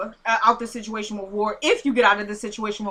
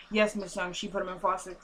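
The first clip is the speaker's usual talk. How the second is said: acted soft, lower-pitched reply; reluctant confirmation; 5 semitones lower, 9 dB quieter than usual. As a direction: break bad news quietly, voice dropped low. Voicing reluctant caller giving bad news.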